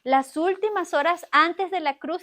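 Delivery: ordinary talk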